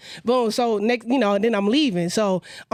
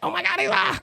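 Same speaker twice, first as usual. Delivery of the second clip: mock starstruck; 8 semitones higher than usual. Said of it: "so I can't be like".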